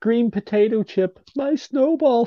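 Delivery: put-on high, whiny child's voice, slowed; mimicking a bratty kid. A friend mocks the annoying little sister from Arthur, whiny. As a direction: whine it like a spoiled little sister.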